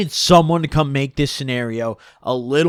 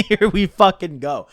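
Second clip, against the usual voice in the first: laughing; 5 semitones higher, 5 dB louder than usual. Real, not acted. Laughing at the coming fight.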